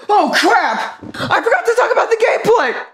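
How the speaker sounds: high, panicked realization; sudden panic. Real, not acted. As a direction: panic as you remember. Sudden panic, much higher.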